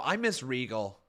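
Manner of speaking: ordinary delivery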